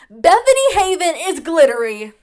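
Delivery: loud, high announcement; mock proclamation. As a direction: proclaim it to the world with flourish.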